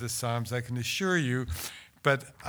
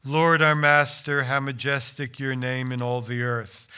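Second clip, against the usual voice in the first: louder, solemn recitation of the psalm; psalm reading; 5 dB louder than usual. Acted. Translator reading his psalm aloud to the audience, solemn and loud. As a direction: proclaim it like a psalm.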